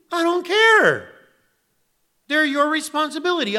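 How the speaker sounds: loud, high, scolding voice of God; stern God scolding. Acted. God scolding Leon over his hungry flock, in a loud stern voice. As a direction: demand answers like an angry master.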